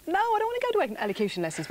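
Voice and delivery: high mock-childish refusal; child's protest. Guest recalls refusing lessons at five, high childish protest. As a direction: act out a five-year-old digging in against lessons. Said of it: "And so I thought".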